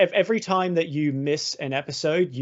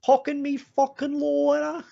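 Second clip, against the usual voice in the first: mocking a commenter's nasal Aussie voice; nasal Aussie bogan voice; 11 semitones higher than usual. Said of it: "my fucking voice is nasally".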